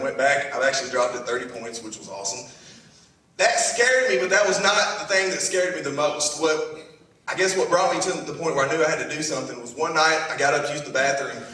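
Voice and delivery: normal talking voice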